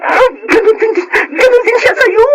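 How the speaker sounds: a friend's high, shocked outburst; alarmed exclaiming. Acted.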